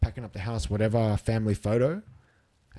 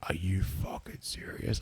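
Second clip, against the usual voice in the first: slow, hushed disbelief as a shocked dad; stunned whisper; 2 semitones lower, 8 dB quieter, slower than usual. Retold or imitated. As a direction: whisper it slowly, as a dad taking in bad news. Dad's stunned reply to bad news.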